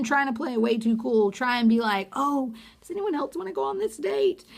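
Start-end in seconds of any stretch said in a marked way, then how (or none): 2.1-4.4 higher, light joking tone; mock joking offer. Imitating Jubilee joking to play it cool. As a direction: toss it off as a too-cool joke.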